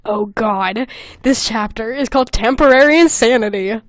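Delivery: ordinary talk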